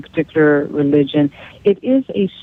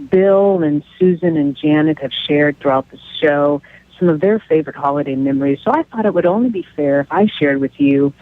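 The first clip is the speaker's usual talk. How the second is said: ordinary talk, nothing marked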